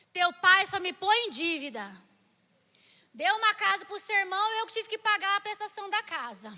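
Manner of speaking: loud, indignant retold complaint; mock maternal rant; scolding mother. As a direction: grumble loudly about the husband.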